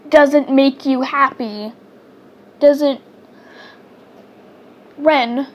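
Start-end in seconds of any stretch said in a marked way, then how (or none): none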